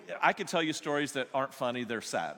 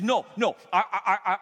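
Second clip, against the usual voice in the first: much louder and higher stammering protest; earnest protest; 6 semitones higher, 9 dB louder than usual. Retold or imitated. Jeff protesting to the pastor, loud and stammering. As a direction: blurt it out in a rush of protest.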